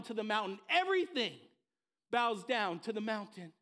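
loud, driving preacher's refrain; full-force preaching. Acted. Pastor rallying the church, loud fervent preaching refrain. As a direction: hammer each line home with fire.

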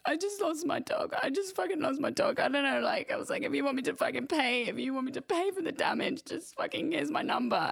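high, wailing plea through tears; tearful pleading. Retold or imitated. Sobbing owner pleads with the driver she hit, high and tearful. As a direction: beg them in a breaking, crying voice. Distraught owner to stranger after crash.

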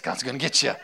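lightly voiced fire-and-brimstone preacher; a hint of a hellfire Baptist warning; mock Southern preacher threat; crazy Southern Baptist. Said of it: "the crazy Southern Baptist".

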